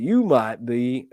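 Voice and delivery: slow Southern comedian drawl; drawn-out Southern twang; Jeff Foxworthy. Acted. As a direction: stretch it out in a Southern accent. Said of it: "like Jeff Foxworthy".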